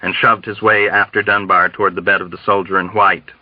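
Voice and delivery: plain reading voice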